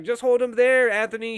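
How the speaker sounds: Kathleen on the radio. Retold or imitated. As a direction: bark it like a ruthless leader. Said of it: "He's like".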